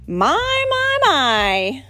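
loud, showy drawl. Acted. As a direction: boom it to the group. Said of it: "Stinkin' said loudly".

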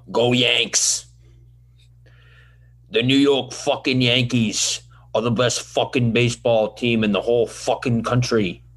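Italian New York Yankee fan; hammed-up New York Italian fan accent. Host as devoted Yankee fan, Italian New York accent. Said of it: "an Italian New York Yankee fan?".

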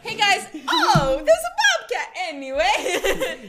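high, loud, stretched fake shock; mock vlogger surprise. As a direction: play a hammed-up influencer startled mid-intro. Acting out a video host interrupted.

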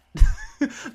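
short breathy high laugh; quick real laugh; burst of laughter. Real, not acted. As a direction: laugh at your own punchline. Laughs at own exploit joke.

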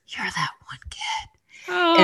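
slow, tender whisper imitated. Foster mom whispering in the kid's ear, whisper. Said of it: "always whispers in my ear".

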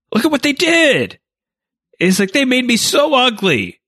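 high whining mock complaint; whiny Palpatine. Palpatine complaining to the senators, high whining voice. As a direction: whine to the senate like a wounded victim.

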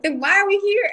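exasperated question; baffled husband. Retold or imitated. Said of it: "He's like".

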